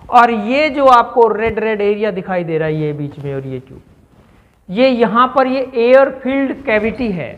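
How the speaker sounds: ordinary delivery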